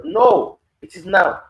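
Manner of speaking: emphatic shout. Real, not acted. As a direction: cry out that the time is now. Preacher answers his own question.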